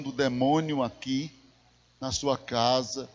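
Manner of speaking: low, softened, measured voice; calm pastoral counsel. Retold or imitated. Preacher re-enacting his talk with a crying church member, calm and low. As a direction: speak low and gently, like counselling someone.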